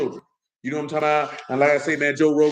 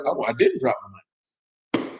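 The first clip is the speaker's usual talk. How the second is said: relieved; 5 semitones lower, slower than usual. Retold or imitated. Relief finding his bill.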